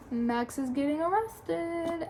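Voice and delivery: usual speaking voice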